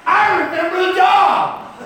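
imitating a high, drawn-out shout; hammed-up yell of sudden recognition. Retells driver's stunned recognition.

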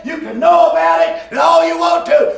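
loud, high, driving preacher cadence; preaching at full force. Acted. Preacher driving home the point to his congregation, full-force preaching.

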